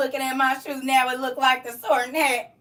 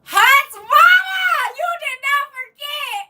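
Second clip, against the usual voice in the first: very high, slow, shrieking delight; excited greeting; 12 semitones higher, 5 dB louder, slower than usual. Real, not acted. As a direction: squeal it out to a friend. Greeting a viewer's comment, delighted.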